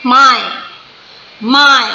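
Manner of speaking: "my" as one loud, falling beat; one single stress. Shown as a demonstration. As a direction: say "my" in one firm stressed stroke, standing alone. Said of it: "एक ही बार बोला stress".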